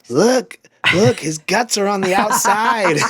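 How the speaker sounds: put-on high, loud, drawn-out kid voice; excited little girl. Daughter showing off her decorated cookie Santa, excited little girl. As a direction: imitate a small child delightedly pointing something out. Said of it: "And she's like".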